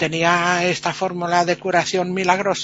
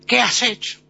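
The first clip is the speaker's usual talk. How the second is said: higher, louder furious question from Death; angry demand; 5 semitones higher, 5 dB louder than usual. Retold or imitated. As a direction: snap it at the doctor like an outraged godmother. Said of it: "La muerte se enfadó". (la Muerte reprocha enfadada, más alta y fuerte)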